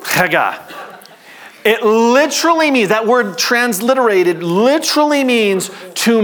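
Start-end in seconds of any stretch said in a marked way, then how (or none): 0.0-0.6 Haggah opening on a phlegm-clearing rasp; phlegmy rasp. Shown as a demonstration. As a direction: scrape the back of the throat on the H of Haggah. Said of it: "trying to cough up some phlegm".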